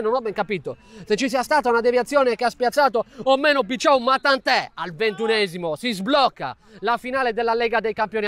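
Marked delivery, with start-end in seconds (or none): none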